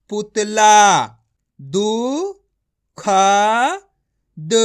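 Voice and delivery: put-on teacher drilling children, slow and spaced, then blended; syllable-by-syllable reading. Shown as a demonstration. Teacher drilling children on words, syllables spaced then blended. Sounds out each word for the class.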